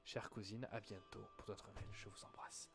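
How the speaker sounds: ordinary talk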